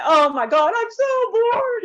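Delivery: high, whiny, dragged-out moan of boredom; a theatrical bored wail, voice cracking; mock bored whine. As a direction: ham up the boredom, slow and high. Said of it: "how many feel disconnect?".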